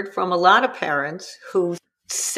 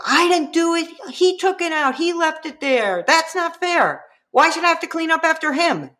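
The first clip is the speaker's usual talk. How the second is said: higher, fast whiny protest; defensive kid; 8 semitones higher than usual. Retold or imitated. Child defending himself against an attacking parent, whiny. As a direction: insist it's not fair.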